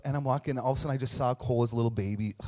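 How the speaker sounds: ordinary delivery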